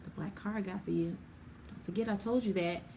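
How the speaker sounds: soft, hushed and a little quick; a quiet nudge, not a shout; gentle quiet reminder. Retold or imitated. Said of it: "he's not gonna yell it to you".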